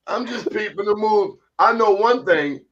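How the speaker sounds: ordinary talk, nothing marked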